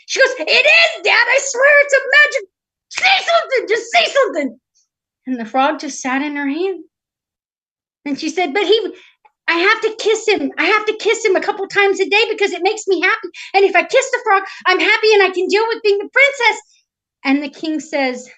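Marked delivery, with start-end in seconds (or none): 0.4-4.6 very high, loud, desperate plea; frantic princess. Acted. Princess frantically begs frog to talk. 8.5-16.6 high, loud, insistent pleading; desperate princess. Acted.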